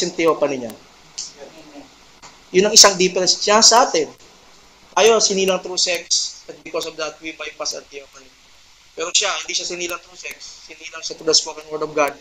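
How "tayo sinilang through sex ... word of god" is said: much softer, confiding preacher; hushed. Acted. Preacher confiding to his congregation about birth, hushed. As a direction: bring it down low to draw them in.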